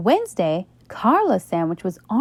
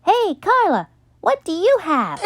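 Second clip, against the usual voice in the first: much higher, louder taunting call; teasing; 11 semitones higher, 4 dB louder than usual. A narrator's reading. Buster calling to Carla to mock her lunch, taunting. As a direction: needle her.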